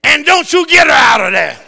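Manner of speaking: loud, high pointed warning with a laugh; mock strict wife, half laughing; stern wife warning. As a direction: warn him off like a drill sergeant. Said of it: "and she points at me".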